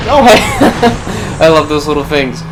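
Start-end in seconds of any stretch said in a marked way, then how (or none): 0.1-0.4 high, loud burst of delight; pleasant surprise. Real, not acted. Player spots a welcome message mid-commentary, delighted surprise. 0.4-1.4 short, high-pitched chuckle; amused laugh. Real, not acted. Player chuckling at a message liking his notes, amused.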